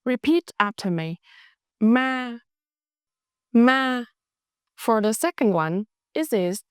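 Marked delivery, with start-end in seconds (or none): 1.8-2.4 "Ma" held level on a higher pitch; flat tone. Shown as a demonstration. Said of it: "Flat tone, steady pitch". 3.5-4.0 "Ma" held steady, higher and louder; flat tone. Shown as a demonstration. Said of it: "steady pitch".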